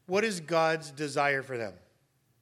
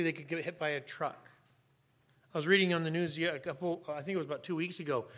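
ordinary talk

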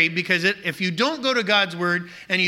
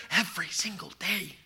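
soft unvoiced whisper, leaning in close; stage whisper; 9 dB quieter than usual. Preacher tells husbands to talk daily, in a whisper. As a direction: let it drop to a confiding whisper.

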